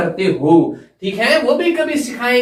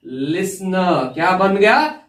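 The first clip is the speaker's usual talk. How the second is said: usual speaking voice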